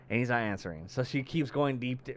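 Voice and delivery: ordinary talk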